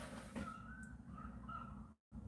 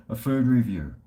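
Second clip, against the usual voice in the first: ordinary talk, nothing marked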